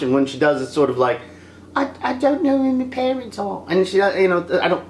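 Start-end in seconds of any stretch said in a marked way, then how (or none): 1.7-3.6 high put-on voice, stammered start; high-pitched mock helplessness; mock Rey. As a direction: mimic her in a high, mocking voice. Mimicking Rey about her parents.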